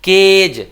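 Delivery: केज with a long, loud "ay"; model pronunciation. Shown as a demonstration. Teacher shows class how to read it, model pronunciation. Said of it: "क्या पढ़े".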